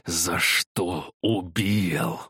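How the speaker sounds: slow, rasping and menacing; hoarse wheeze. A narrator's reading. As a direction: rasp it out slowly, right in his face. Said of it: "прохрипел прямо в лицо".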